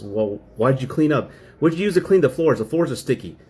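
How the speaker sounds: mock exasperated nagging; retold complaint. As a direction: imitate a wife picking at the cleaning. Acts out wife complaining.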